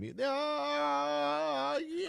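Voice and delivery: high, cracked yelp of pain; mock pained cry. Imitating the play-by-play man hit by a foul ball, as a mock pained yelp.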